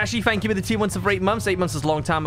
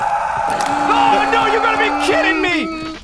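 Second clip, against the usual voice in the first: high frantic shouting; 7 dB louder, much slower than usual. Real, not acted. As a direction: yell in frustration. Caught, losing the doll.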